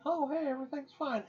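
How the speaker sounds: high, breezy innocence; mock innocent. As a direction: play it falsely innocent. Mock innocent retold self.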